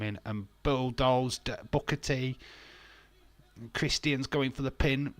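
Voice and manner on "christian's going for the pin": ordinary talk, nothing marked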